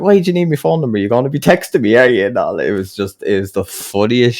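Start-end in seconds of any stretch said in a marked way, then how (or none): 0.0-2.3 high, loud retold grilling; mock indignant challenge. Customer challenging young lad over his phone number, mock indignant. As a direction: imitate a suspicious customer having a go. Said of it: "he must have ripped into the poor young lad".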